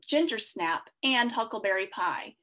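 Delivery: ordinary delivery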